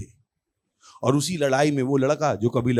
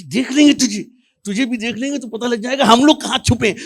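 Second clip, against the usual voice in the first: high, urgent mock panic; panicky warning; 7 semitones higher, 5 dB louder than usual. Hiding soldier warning they will be seen, mock panic. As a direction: warn him in an agitated high voice.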